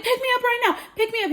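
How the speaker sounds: high, squealed urgent plea; frantic demand. Retold or imitated. Upset wife phones husband for rescue, frantic demand. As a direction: squeal it in a retold panic.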